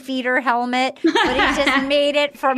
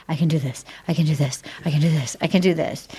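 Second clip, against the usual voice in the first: low, repeated self pep talk; retold self-encouragement; 7 semitones lower, much faster than usual. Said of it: "seven times".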